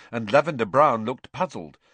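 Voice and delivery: ordinary delivery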